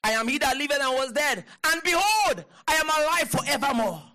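high, forceful proclamation; fervent preaching. Real, not acted. Preacher declaring Christ alive to the congregation, high fervent shout.

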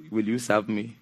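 very low, hushed voice of the Lord; voice of God. Acted. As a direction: drop deep and quiet as God calling. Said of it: "The Lord said".

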